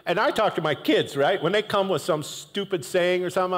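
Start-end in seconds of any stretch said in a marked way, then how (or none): none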